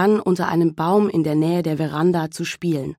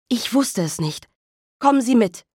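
cry out; 6 semitones higher than usual. Acted. Mother calling the officer into the house, shouting. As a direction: build to a frightened shout. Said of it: "schrie Misses Lennox".

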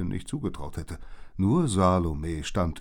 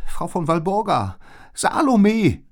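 high, loud alarm call; gerufen; 13 semitones higher, 12 dB louder than usual. A narrator's reading. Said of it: "rief Liz". (laut und hoch gerufen)

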